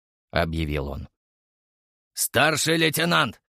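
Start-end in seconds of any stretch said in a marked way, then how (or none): 2.1-3.4 slow growled warning; growling. A narrator's reading. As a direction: growl his rank, drawn out. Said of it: "рыкнул сержант".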